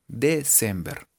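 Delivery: December slowly, "de... sem-ber"; spelling reading. Shown as a demonstration. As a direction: sound out December as written. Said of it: "se escribe". (December lento, como se escribe)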